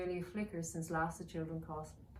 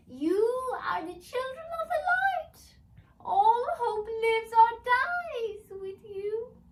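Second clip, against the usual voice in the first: high, loud, slow wail; crying elf wail; 11 dB louder, slower than usual. Acted. Tormented elf wailing to the children, high and crying. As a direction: plead like a haunted creature.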